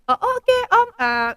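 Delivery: high, loud flustered voice; daughter caught off guard. Retold or imitated. Daughter to the date, high and flustered. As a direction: fake polite surprise. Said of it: "katanya gitu kan".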